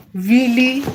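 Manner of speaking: high, loud disbelieving "Really?"; surprised. Real, not acted. Streamer reacting to chat's teasing, surprised. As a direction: pop up in disbelief.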